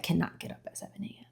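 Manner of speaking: hushed confession, barely voiced; soft confiding whisper. Real, not acted. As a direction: whisper it like a guilty secret. Confessing harsh self-talk.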